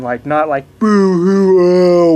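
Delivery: high, loud fake boo-hoo sob; hammed-up boo-hoo wail; mock whiny cry. Shown as a demonstration. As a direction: parody a whiny crybaby. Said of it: "whiny kind of cry".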